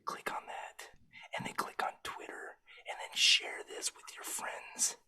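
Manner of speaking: soft unvoiced whisper. Real, not acted. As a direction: whisper it under your breath. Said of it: "why you whispering?".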